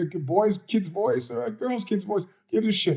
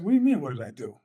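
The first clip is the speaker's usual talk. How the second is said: retold defensive guy; 5 semitones lower, faster than usual. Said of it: "the guy looks at me and says".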